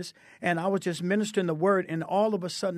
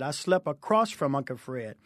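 normal talking voice

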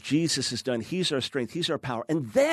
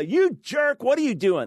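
high, loud mock yell at another driver; retold yell; 9 semitones higher, 4 dB louder than usual. Cut off in traffic, yelling at the other car, a hammed-up angry yell. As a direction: bark it out like road rage. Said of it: "I yell".

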